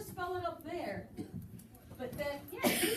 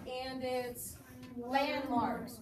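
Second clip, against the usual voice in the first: slow dictation; much slower than usual. Shown as a demonstration. Dictating slowly.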